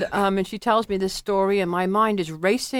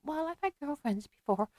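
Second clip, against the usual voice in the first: small, high, hushed retold voice; softly squeaked out, higher than usual; tearful; 4 semitones higher, 11 dB quieter than usual. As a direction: imitate a timid tearful admission. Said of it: "then she burst into tears".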